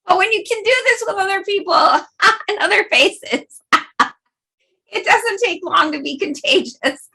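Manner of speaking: laughing. Real, not acted. Laughing while explaining.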